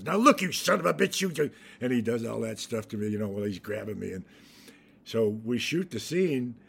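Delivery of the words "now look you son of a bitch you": imitating a menacing actor, louder; a hammed-up tough-guy growl; mock threat. Actor retelling a co-star grabbing him, mock threat. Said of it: "he goes".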